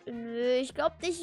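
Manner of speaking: ordinary talk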